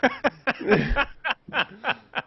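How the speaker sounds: high, loud burst of laughter; hard laughter. Real, not acted. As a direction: crack up helplessly. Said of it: "I'm laughing so hard".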